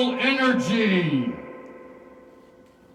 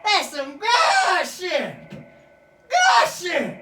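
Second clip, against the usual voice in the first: emphatic praise; 5 semitones higher, much slower than usual. Real, not acted. Keeps praising the scene.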